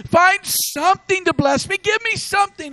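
high, pleading cry; desperate plea. Acted. Esau begging his father for some blessing, desperate plea. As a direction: beg for anything at all. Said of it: "He was desperate".

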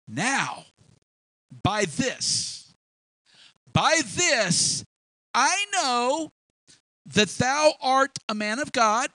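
high and loud, full of new faith; joyful realisation. Acted. Widow to Elijah after her son revives, thrilled. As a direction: let her faith burst out.

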